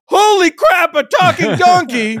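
high, loud hammed-up yell of shock; mock shout. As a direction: yell it out like a startled rider. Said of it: "Doesn't go".

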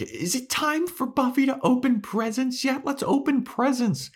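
put-on very high whiny kid voice; mock childish Dawn. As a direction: beg like a little child.

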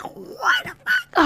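very high, drawn-out squeak; shocked disbelief. Retold or imitated. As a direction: squeak it slowly in mock shock. Retold shock at the letter.

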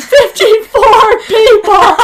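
laughing through the words; breaking into laughter mid-sentence. Real, not acted. Laughing fit telling the story.

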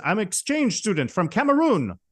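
high put-on voice, sing-song; put-on character voice. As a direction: play the comic fake student. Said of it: "he's putting on this voice".

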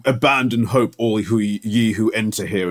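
slow, doom-laden announcer voice; ominous ride warning. Retold or imitated. Friend mocks the ride's scary signs, slow ominous tone. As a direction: intone it like a spooky warning sign. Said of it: "they always do this thing".

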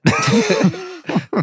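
laugh. Real, not acted. Laughs at own accent.

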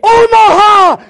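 high, loud, drawn-out code call; long full-voiced holler to a player; sideline yell. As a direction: yell it across the football field. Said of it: "and I'd be yelling".

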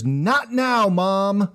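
put-on high, exasperated teenage-style holler to mom; mock yell upstairs. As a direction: yell it upstairs, pitch jumping up. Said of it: "just yell upstairs".

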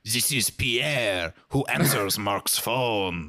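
slow, put-on butler voice; Pierre the butler. Friend plays the butler answering Mark's phone, mock-formal. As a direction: answer ceremoniously, drawn out and a little low. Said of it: "as my butler".

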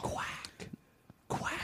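breathy whispered duck quacks; soft imitated quacking. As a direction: whisper the quacks like a distant fan. Fans quacking at stadium.

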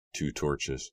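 ordinary delivery, nothing marked

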